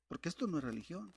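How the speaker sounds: usual speaking voice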